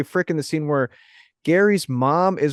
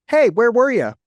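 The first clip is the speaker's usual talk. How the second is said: high, loud mock dad calling out; a dad hollering at his daughter; 8 semitones higher, 3 dB louder than usual. Imitating her dad calling out where she was. Dad voiced in retold scene.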